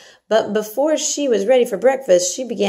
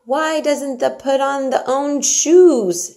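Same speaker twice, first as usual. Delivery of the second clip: higher, louder, slow puzzled maid; puzzled Yorkshire maid; 8 semitones higher, 6 dB louder, slower than usual. A narrator's reading. Maid wondering why the girl won't dress herself, puzzled. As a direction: ask in honest disbelief.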